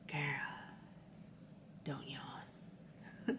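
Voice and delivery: breathy whisper to herself; whispered inner voice. Retold or imitated. Her own psyche scolding her not to yawn, whispered. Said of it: "My psyche's like".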